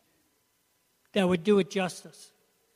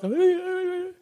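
high, sustained imitated wail; mock crying; 11 semitones higher than usual. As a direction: let out a high whimper as if unable to speak. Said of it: "if I open my mouth".

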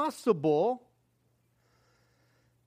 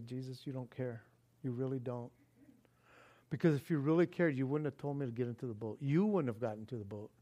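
hushed bitter complaint; 9 semitones lower, 8 dB quieter, faster than usual. Retold or imitated. Crying out to Jesus.

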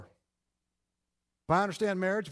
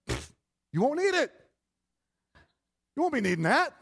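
eager husband; 9 semitones higher, 4 dB louder than usual. Retold or imitated. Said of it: "And a man would say".

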